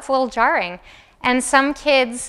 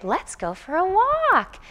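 higher, slow, sing-song lift up; excited caregiver; 6 semitones higher, 4 dB quieter, slower than usual. Shown as a demonstration. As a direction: rouse the baby with a rising lilt. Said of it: "let babies know when it's time to get excited".

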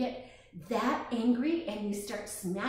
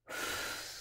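slow audible exhale; long soft calming sigh; calming breath out; 9 dB quieter than usual. Shown as a demonstration. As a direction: show the kids how to breathe out. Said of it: "Breathe in again".

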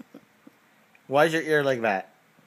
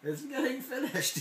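much higher put-on dog voice; voicing the other dog; 10 semitones higher, 5 dB quieter than usual. Dad voices Charlie taunting Oliver in a high put-on voice. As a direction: speak for Charlie, chirpy and high. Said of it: "he said".

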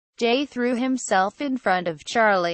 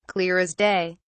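usual speaking voice

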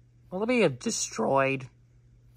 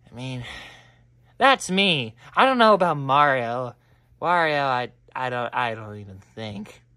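normal talking voice

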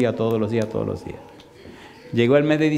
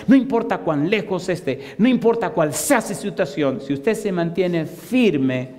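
preaching at full force, rising higher and higher; fervent preaching; 4 semitones higher than usual. Acted. Preacher urging the congregation to persist, fervent and rising. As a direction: build the exhortation up like a pulpit climax.

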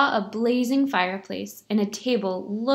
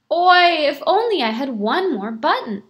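loud, higher Yiddish-style oi; loud lament; 5 semitones higher, 6 dB louder than usual. Acted. Beggar hinting loudly to the silent shamas, hammed-up lament. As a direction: sigh it out so the shamas hears.

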